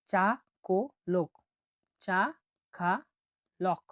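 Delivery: ছাখালক broken into চা খা লক, each part separate; syllable by syllable. Shown as a demonstration. Teacher splits ছাখালক into syllables for learners. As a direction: sound out ছাখালক one syllable at a time. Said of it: "দেখুন".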